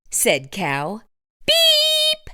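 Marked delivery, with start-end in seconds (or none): 1.5-2.3 very high horn beep; a little truck's beep; cheerful high beep. A narrator's reading. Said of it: "said Blue".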